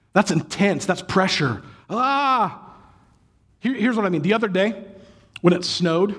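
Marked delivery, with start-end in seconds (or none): 1.9-2.7 pained cry. Real, not acted. Pained reaction to verse.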